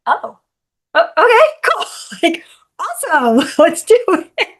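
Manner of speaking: laughing surprise. Retold or imitated.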